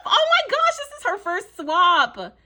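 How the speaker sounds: high, loud delighted squeal on oh my gosh; excited squeal. Real, not acted. Learning the sender's first swap, a delighted squeal. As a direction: squeal with joy at the news.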